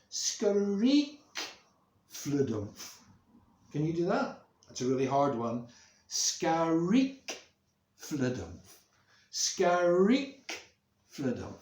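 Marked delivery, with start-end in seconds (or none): 0.1-3.0 creaking swing. Acted. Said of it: "I love the sound the swing makes". 6.1-11.5 loud rising squeak, dropping thud; rhythmic up-and-down swing noises; creaking swing. Shown as a demonstration. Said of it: "It goes like this".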